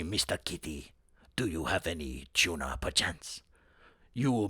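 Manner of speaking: low, soft, grand ghost cat; deep, hushed mock-noble cat voice. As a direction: purr it out like a regal spirit cat.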